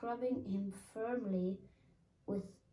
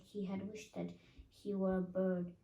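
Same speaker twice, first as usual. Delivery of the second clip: ordinary delivery, nothing marked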